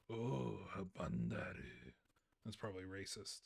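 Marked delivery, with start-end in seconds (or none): 0.1-1.9 put-on low, slow caricatured Japanese accent; hammed-up Japanese accent, dropping low; mock Japanese accent. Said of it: "a mock Japanese accent".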